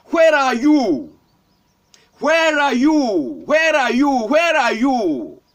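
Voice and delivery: drawn-out, insistent calling out; God shouting. Retold or imitated. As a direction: call out slowly, stretching each falling phrase. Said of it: "God is shouting".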